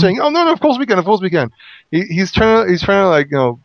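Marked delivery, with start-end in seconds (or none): 0.2-1.5 high, hurried reassurance; eager protest. Retold or imitated. The people assure Joshua they can, eagerly protesting. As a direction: insist at a high pitch, then settle.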